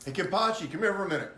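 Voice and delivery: loud, high summons; calling someone over. Acted. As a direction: holler across to a companion. Player calls Kenpachi over.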